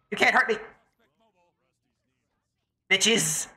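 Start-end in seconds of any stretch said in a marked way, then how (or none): none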